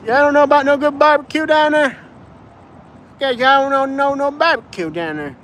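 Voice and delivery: high-pitched, garbled hillbilly twang; Alabama accent. Acted. Local telling a traveler about barbecue, high hillbilly accent. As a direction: imitate an incomprehensible Appalachian backwoods accent. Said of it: "What the crap did that guy just say?".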